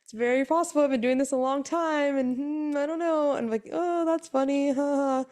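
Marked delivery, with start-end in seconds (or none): 0.0-3.3 knowing nurse. Retold or imitated. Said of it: "laughed, was just like". 3.7-5.2 high, mock polite laugh; fake laugh. Wife dismissing the twin joke, forced high laugh.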